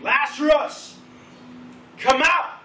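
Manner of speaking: booming acted scream; thunderous shout. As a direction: call out at full voice to summon someone. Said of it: "basically screamed".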